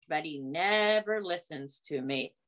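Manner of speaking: ordinary delivery, nothing marked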